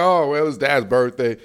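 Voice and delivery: low, soft and offhand, no big deal; unbothered shrug. Retold or imitated. Son shrugging off his dad's birthday, low and offhand.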